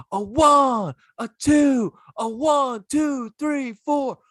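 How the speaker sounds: very high, loud band count-off; screamed count-in. Retold or imitated. Imitating regular who isn't in the band, screaming it out. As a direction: shout out the count like a bandleader. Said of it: "be screaming it out".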